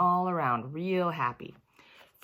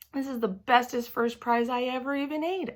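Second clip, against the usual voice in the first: higher, bubbly happy voice; overjoyed child thanking; gushing thanks; 9 semitones higher than usual. Acted. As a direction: gush to the lunch lady. Junie thanking lunch lady happily.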